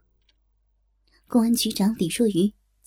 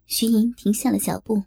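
usual speaking voice

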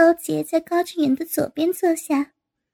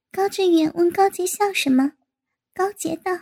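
ordinary delivery, nothing marked

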